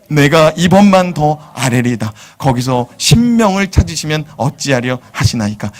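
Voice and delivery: measured solemn Bible reading; scripture reading. Acted. Pastor reading Genesis to the congregation solemnly.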